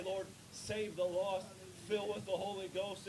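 ordinary talk

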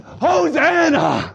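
high, long drawn-out shout; desperate cry of praise. Retold or imitated. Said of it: "It's shouting with the intent of desperation".

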